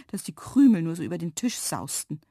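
ordinary delivery

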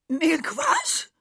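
high, loud bleat; bleating goat. A narrator's reading. Goat is thunderstruck, bleating in disbelief. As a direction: bleat out the question. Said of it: "meckerte die Ziege".

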